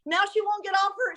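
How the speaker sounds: ordinary delivery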